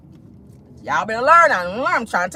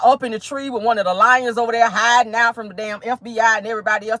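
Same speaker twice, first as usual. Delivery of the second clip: ordinary delivery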